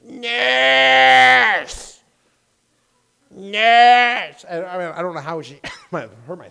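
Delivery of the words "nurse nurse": much higher and much louder, a long drawn-out call for the nurse. Retold or imitated. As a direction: yell for the nurse across the ward. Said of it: "She goes".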